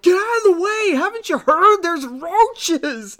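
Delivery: high, loud panicked yelling; panicked townsfolk yell. Retold or imitated. Townsperson yells at Scully to get out of the way, loud and panicked. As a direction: shout it like a frantic shopper fleeing town. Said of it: "the people are like".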